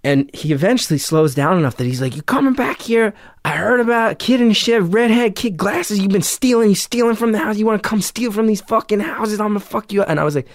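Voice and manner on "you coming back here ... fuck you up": much higher, ranting mock shout; imitated angry yelling. Angry driver threatens the supposed thief, high ranting yell. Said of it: "we're yelling at each other".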